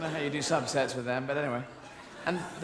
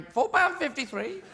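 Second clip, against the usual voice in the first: high, slow parrot squawk; 10 semitones higher, slower than usual. Retold or imitated. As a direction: say the price like a parrot. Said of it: "a bird on your shoulder going".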